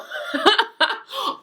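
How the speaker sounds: high-pitched burst of laughter. Real, not acted. Host cracking up at her own romance parody, high laugh.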